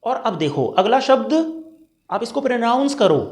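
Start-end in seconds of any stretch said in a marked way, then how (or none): none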